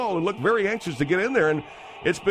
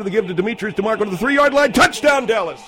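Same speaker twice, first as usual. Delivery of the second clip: fast, rising announcer call to a loud touchdown; 4 semitones higher, 4 dB louder than usual. Acted. Announcer calling the touchdown to viewers, hyped. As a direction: build it up and explode on touchdown.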